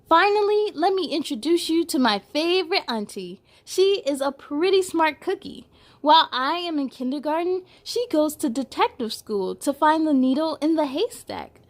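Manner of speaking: bright read-aloud storytelling; picture book read to little kids; story narration. A narrator's reading. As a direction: read it to children, lively and clear.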